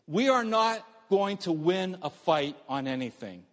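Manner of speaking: ordinary delivery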